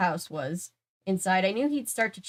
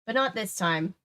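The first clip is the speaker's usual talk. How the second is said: ordinary delivery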